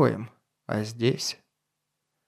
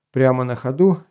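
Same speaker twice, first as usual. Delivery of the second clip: usual speaking voice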